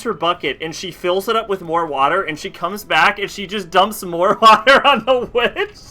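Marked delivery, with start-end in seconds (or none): 2.2-5.8 laughing while telling. Real, not acted.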